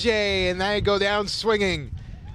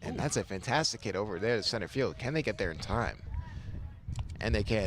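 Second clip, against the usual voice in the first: golf voice; 7 semitones lower, 8 dB quieter than usual. Pretend. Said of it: "stop this golf voice".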